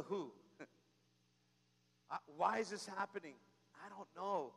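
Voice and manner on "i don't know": usual speaking voice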